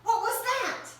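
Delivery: high startled question. Acted. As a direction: jump at the sound. Startled at knock.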